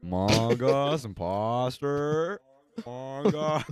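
very slow, drawn-out game voice; Among Us voice. Retold or imitated. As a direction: stretch each word like the game. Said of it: "Among Us and Synthetic Symphony".

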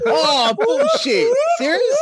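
can't believe the guess was right; stunned disbelief. Real, not acted. Friend stunned by the other's perfect guess, high disbelief.

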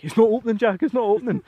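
high, loud frantic plea; panicked friend. Retold or imitated. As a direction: imitate Jamie's panic at the stuck door. Panicking friend at locked door.

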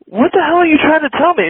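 high-pitched exasperated question; baffled. Retold or imitated. Confused pilot asking controller what he is trying to say, baffled. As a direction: ask it high, in mock disbelief.

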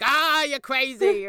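loud, slow mock exasperation; exasperated. Pretend quarrel, loud exasperated brush-off at partner. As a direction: throw up your hands at her. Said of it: "constantly exasperated with you".

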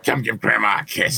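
put-on old lady coo; elderly singsong. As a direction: beckon like a doting grandma. Voicing a grandma.

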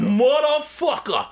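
high, slow, savoured swear; hammed-up exclamation; drawn-out curse. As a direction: stretch every syllable of the curse. Acting out reaction to lurking Tommy.

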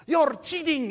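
high-pitched Irish outburst; mock Irish accent. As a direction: accuse in an exaggerated, angry Irish accent. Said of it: "he's got the accent".

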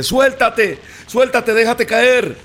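high, loud pleading call, slow; father calling. Acted. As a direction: urge him to let go, calling up. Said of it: "el papá le dice". (padre gritando que se suelte)

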